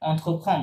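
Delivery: অন্থ ফং with the main verb sounded at the end; main-verb pronunciation. Shown as a demonstration. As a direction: model অন্থ ফং for a learner. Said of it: "main verb উচ্চারণ হবে".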